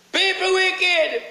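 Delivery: high, slow, drawn-out preaching cry; slow soaring pulpit cry; preached refrain. Acted. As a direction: stretch it out like a sermon punchline.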